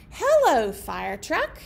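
expressive read-aloud for toddlers; animated picture-book reading; reading to children. Acted. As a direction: read it like story time.

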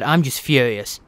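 usual speaking voice

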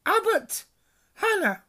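high, loud stern roll call; calling a name. A narrator's reading. McGonagall calls the next student to the stool. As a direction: call the name out across the hall.

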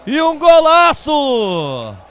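loud, stretched goal shout; explosive goal commentary. Real, not acted. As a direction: scream the goal out long.

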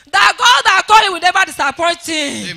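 loud, forceful church prayer; fervent prayer. Real, not acted. Testifier blessing the daddy G O before church, fervently. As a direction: pray it out with full force. Prays aloud for the G O.

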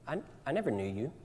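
soft, sombre verdict; a hint of a grave judge; quiet rejection. Jesus voiced rejecting terrified man.

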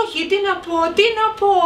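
high, rising cry of disbelief; exasperated. Acted. Lady despairs at the boy who hates vegetables, pitch climbing. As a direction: throw up your hands in disbelief.

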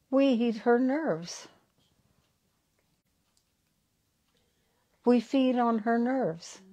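slow, tiny creature voice, lightly done; a hint of a small fairy speaking; little prickle voice. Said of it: "they always talk this little, little voice".

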